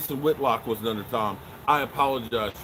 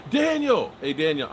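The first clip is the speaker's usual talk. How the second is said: calling out; 3 semitones higher, 3 dB louder than usual. Real, not acted. Calling out to a viewer.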